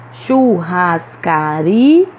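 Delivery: "Churrascaria" very slow, syllable by syllable, ri rising strongly; stress on penultimate syllable. Shown as a demonstration. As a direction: stretch "Churrascaria", peaking on ri before a detached a. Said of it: "pronunciamos com mais força a penúltima". ("Churrascaria" bem devagar, silabado, com força em ri)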